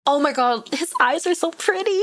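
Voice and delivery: high shrieking laugh; giddy laughing. Real, not acted. Gushing over character's eyes.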